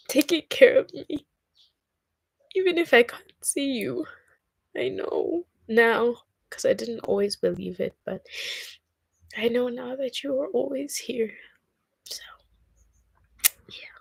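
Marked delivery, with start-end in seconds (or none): 0.1-6.2 starting to cry. Real, not acted. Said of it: "and now I'm crying". 9.4-14.0 real tears, whispered and higher; a real choked whisper, softer; tearful whisper. Real, not acted. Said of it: "and now I'm crying".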